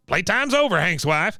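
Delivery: put-on high-pitched bossy old veteran voice; Cotton. Veteran interrupting his son's wife, imitated bossy announcement. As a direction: imitate a domineering father-in-law barging in. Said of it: "Cotton comes in and goes".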